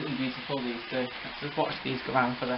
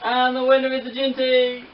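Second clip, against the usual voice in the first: racehorse commentator; 6 semitones higher than usual. Pretend. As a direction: announce the winner with a flourish. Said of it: "talk like a racehorse commentator".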